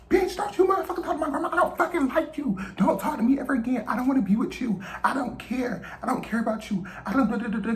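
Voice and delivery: screaming high and fast at him; furious high yelling. Retold or imitated. Cussing out the boy in front of her grandma, high furious yell. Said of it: "I was like".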